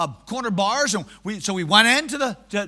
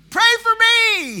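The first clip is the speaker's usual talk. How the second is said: much higher, loud pleading cry; desperate cry; 11 semitones higher, 6 dB louder than usual. Retold or imitated. Withered-arm king begging the prophet, desperate loud cry. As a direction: beg with panic.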